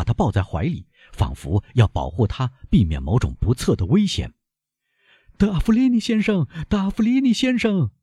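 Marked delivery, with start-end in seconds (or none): 5.4-7.9 very high, strained cry for the doctor; panicked, stifled high call; choked scream. A narrator's reading. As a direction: scream a name through a tight throat. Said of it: "用憋住的声音喊道".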